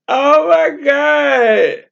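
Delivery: slow, drawn-out shout of joy; stretched joyful cry. Real, not acted. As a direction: let it ring out in disbelief. Overjoyed at first donation.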